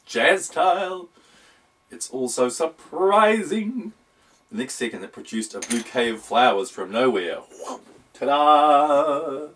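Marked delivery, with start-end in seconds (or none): 0.1-3.9 high, loud and slow, a showman's sing-song; over-the-top high worm voice; jazzy worm. Acted. Worm voiced showing jazz hands. 8.1-9.5 loud, drawn-out showman's ta-da; big theatrical ta-da; ta-da reveal. Acted. Worm's magic reveal.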